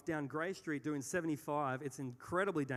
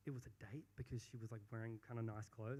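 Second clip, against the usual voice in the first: hushed realization; 4 semitones lower, 11 dB quieter, faster than usual. Retold or imitated. Muttering realization to himself.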